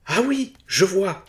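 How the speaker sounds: high, slow, loud cry of relief; a relieved cry of realisation; relieved exclamation. Acted. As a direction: burst out with relief, saved from damnation. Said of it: "s'exclama-t-il".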